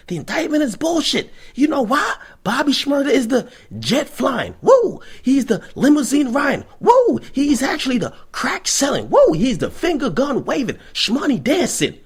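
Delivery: mock his courtroom hype, whooping; imitating the hype lawyer. Impersonating [name]'s lawyer hyping him to the media. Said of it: "This is exactly how he was like".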